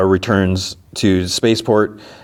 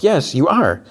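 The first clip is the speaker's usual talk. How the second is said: high-pitched mock bickering; childish contradiction; 10 semitones higher than usual. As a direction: argue back like a kid, lightly acted. Acts out childish back-and-forth quarrel.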